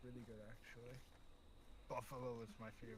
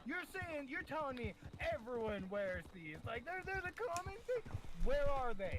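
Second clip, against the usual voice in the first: incredulous retelling; 13 semitones higher, 8 dB louder than usual. Retold or imitated. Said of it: "I'm, uh, I'm like".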